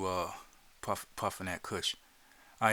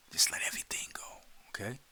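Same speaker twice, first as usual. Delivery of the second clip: whisper; 6 dB quieter, much faster than usual. Acted. Soothing relaxation guide.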